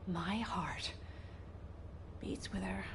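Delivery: entranced whisper. Acted. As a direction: breathe it out, spellbound.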